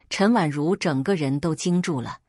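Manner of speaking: ordinary delivery, nothing marked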